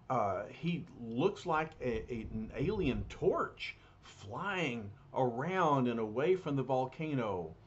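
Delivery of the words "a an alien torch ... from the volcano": ordinary delivery, nothing marked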